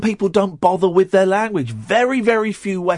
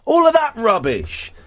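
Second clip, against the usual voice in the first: ordinary talk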